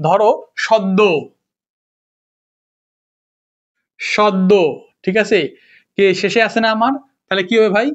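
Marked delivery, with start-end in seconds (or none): 0.6-4.5 সদ্য as "shod-do"; doubled d. Shown as a demonstration. Teacher giving an example of the rule, সদ্য as "shod-do". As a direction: hold the d in সদ্য, ending in o. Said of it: "দ্বিত্ব উচ্চারণ হবে".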